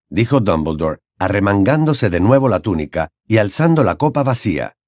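plain reading voice

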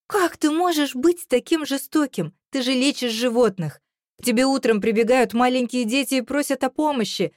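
high, upset pleading accusation; tearful reproach. A narrator's reading. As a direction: plead as if close to sobbing. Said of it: "всхлипнула я".

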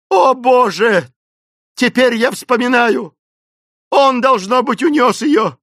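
high, loud drawn-out cries; wailing lament. A narrator's reading. Esmeralda remembering who carried Jane off, wailing. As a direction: cry out in horrified recollection. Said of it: "принялась рыдать и выкрикивать причитания".

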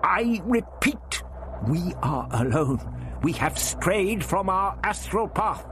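the doctor insisting to the voice, vehement; vehement old man. A narrator's reading. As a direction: insist on the truth. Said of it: "the doctor was answering vehemently".

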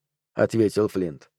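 ordinary delivery, nothing marked

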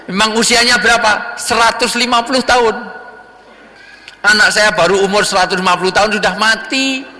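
story dialogue voice. Acted. Dialogue about dead son.

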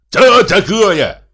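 high, loud, drawn-out yell; shouted retort. A narrator's reading. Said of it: "закричал Михей Егорыч". (крикливо, высоким голосом)

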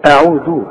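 أعوذ with the tongue out for "dh"; interdental dhal. Shown as a demonstration. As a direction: say the ذ in أعوذ as a clear "th" in "this". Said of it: "لازم تطلع لسانك فيها". (أعوذ بذال واللسان طالع)

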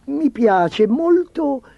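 high, slow, overdone praise; exaggerated, uneasy admiration; feigned enthusiasm. A narrator's reading. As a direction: stretch out the compliment in a high voice. Chancellor pretends to admire nothing.

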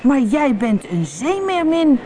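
high, slow exclamation; amazed, stretched-out character line; astonished boy. A narrator's reading. Boy discovers she is a mermaid.

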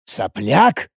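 low, disbelieving echo of "Сопляк?"; переспрос. A narrator's reading. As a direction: repeat "Сопляк?" as a rising question before exploding. Первый, тихий переспрос перед взрывом. (глухо переспросить «Сопляк?»)

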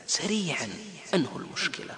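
hushed, urgent whisper; soft pressing whisper; whispered urging. Real, not acted. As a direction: lean in and whisper the plea. Urging listeners softly.

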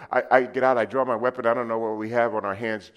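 ordinary talk

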